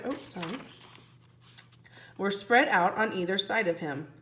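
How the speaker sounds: ordinary delivery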